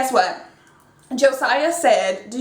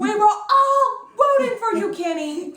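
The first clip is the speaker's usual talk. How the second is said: much higher, drawn-out theatrical outburst; Tyra; 10 semitones higher than usual. Acted. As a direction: wail it in hammed-up betrayal, falling off on the name.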